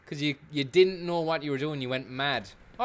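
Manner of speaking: ordinary talk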